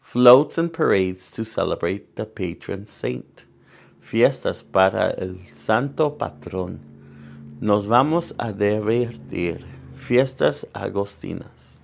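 lively bilingual picture-book reading; reading to children. Acted. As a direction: read it out to the kids, bright and clear. Reads picture book aloud.

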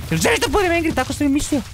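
a playful threatening challenge; mock threat. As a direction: tease the dino with fake menace.

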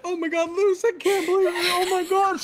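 high, squealing imitation of her shock; mock her disbelief in a high voice; mimicking her friend. Imitating his teammate's shocked disbelief, high squeal. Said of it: "she's like".

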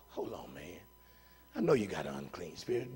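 low, hushed, casual aside; soft, low talking-down; quiet restraint. Acted. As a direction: gently hold someone off, voice right down. Voicing Jesus calming the spirit.

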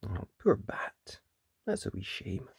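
plain reading voice

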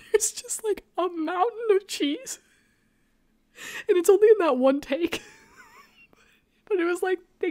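high, squeaky talk through laughter; cracking up. Real, not acted. Podcaster laughing at the movie's cheese continuity error, cracking up. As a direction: struggle to get it out while laughing. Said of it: "This is so funny to me".

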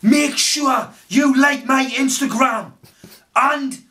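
high, loud and slow sign-off; hammed-up outro yell; loud hype shout. Shown as a demonstration. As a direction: shout it out like a hyped YouTuber. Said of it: "I'll do it like this".

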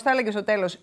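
ordinary talk

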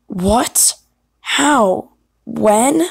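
three separate, swooping questions; acted disbelief, each word jumping up in pitch; startled questions. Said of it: "I'll do more alternatives".

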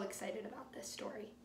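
excited whisper. Real, not acted. Whispered aside to the class.

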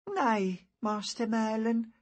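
put-on high boy's voice, a touch weak; weakly. A narrator's reading. Injured boy reassures Master Merlin, a little weakly. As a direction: answer as a mildly weakened hurt lad. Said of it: "he said weakly".

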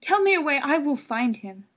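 high, loud, frantic pleading; desperate mother. Acted. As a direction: insist through rising panic. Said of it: "said the mother".

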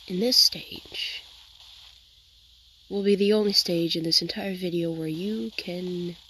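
mock guided meditation. Gamer to her viewers during a quiet build, mock meditation whisper. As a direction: lull the viewers into fake calm, slowly.

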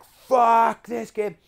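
high, slow, loud fed-up cry; raging at the game. Retold or imitated. Gamer raging at his console, slow loud cry. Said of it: "I was like".

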